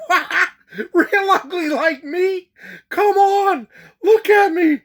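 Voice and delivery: very high, squealing comic protest; mock outrage. Passenger called ugly by attendant, mock outrage. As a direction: build to a loud, high-pitched indignant squeal.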